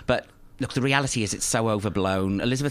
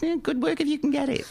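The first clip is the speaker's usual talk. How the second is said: high-pitched aside. Real, not acted. Jokey aside ending in laughter.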